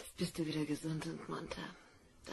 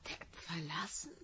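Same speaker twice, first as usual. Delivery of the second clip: puzzled whisper; 8 dB quieter than usual. Acted. Mother puzzled by son's question.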